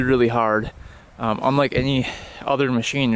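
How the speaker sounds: ordinary talk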